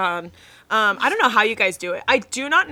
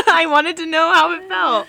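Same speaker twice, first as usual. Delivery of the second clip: laughing; 7 semitones higher, 6 dB louder than usual. Real, not acted. Laughing defense.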